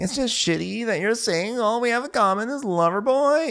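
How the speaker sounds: high-pitched mock complaint; imitated high, aggrieved girlfriend; Amanda. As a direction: whine it in a put-on high voice. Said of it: "So Amanda's saying".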